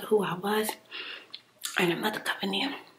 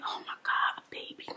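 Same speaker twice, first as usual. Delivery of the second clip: ordinary talk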